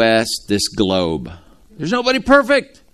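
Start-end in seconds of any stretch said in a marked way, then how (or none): 1.8-2.8 emphatic proclamation. Real, not acted. Preacher emphatic climax.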